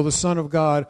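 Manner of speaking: usual speaking voice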